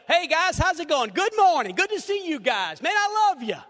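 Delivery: high, loud, beaming hello to the class; enthusiastic greeting. Acted. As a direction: greet them like old friends, all energy. Pastor greets a class warmly.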